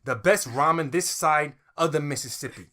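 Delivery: hammed-up slogan; brash pitchman. Attendant boasting about his ramen, brash pitchman. Co-host plays Kim Johnny's boast.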